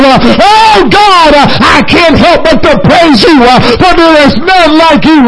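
fervent praying aloud to Jesus, swelling on oh God; a preacher leading by example in prayer; worship prayer. Shown as a demonstration. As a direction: lift hands and pour out praise. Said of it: "I'm just gonna lift my hands, uh, and say".

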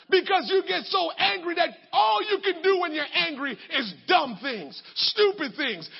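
loud, fired-up preaching; preacher scolding hot-headed anger. Acted. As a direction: drive the point home hard. Preaching at full force.

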